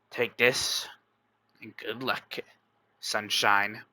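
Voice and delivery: soft, low parting words; a hushed goodbye before letting go; quiet farewell. Acted. Dying man's farewell.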